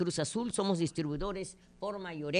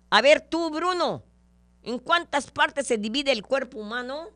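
much higher, louder schoolteacher call; bossy teacher; 7 semitones higher, 5 dB louder than usual. Acted. Teacher calling on Bruno in class, loud and high. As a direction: call on the pupil sharply. Teacher questions student in joke.

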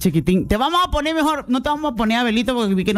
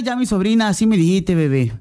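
ordinary talk

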